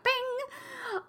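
high, ringing bing; bright-awake bing. Acted. Sun came out and I wasn't ready, high ringing bing. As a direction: sing out a sudden high ding. Sound effect for sudden sun.

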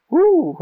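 high retold "woo"; excited whoop. Fan answering his buddy about Jubilee, high excited whoop. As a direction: let out a short high-pitched whoop.

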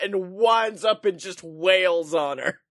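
ordinary talk, nothing marked

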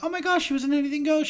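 high, fast, gushing self-quote; excited realisation. Retold or imitated. Host retelling looking up the late actress, excited realisation. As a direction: blurt the discovery in a rush.

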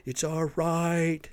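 drawn-out mock defiance; mocking defiant shoppers. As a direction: ham up a smug defiant jerk. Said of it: "they're all just like".

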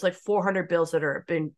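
usual speaking voice

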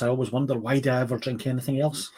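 ordinary talk

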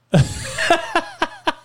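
real high-pitched laughing fit; high cackling laugh. Real, not acted. Host cracking up at his own hairline insult, high cackle.

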